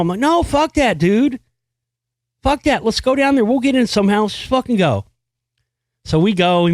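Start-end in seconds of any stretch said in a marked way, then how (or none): 0.2-5.0 high, urgent rallying refusal; defiant insistence. Retold or imitated. Teen urging his friend on to the concert, defiant insistence. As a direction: talk a friend out of giving up, pitched well up.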